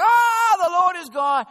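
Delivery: mock overwhelmed shout; awed crowd. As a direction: gasp it out in wonder. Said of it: "everyone's like".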